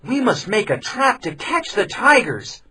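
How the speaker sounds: put-on a young villager proposing a trap; young man's voice. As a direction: state the plan with resolve. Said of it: "he said".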